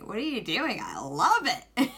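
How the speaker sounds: giddy outburst, stretching the last words out; retold delight. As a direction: burst out in laughing delight.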